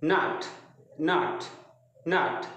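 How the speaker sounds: "not" said crisply with a short o; clear short not. Shown as a demonstration. Teacher shows students how to say "not". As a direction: repeat "not" slowly, teacher modelling sounds. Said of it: "दोबारा बोलिए मेरे साथ".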